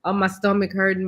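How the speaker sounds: normal talking voice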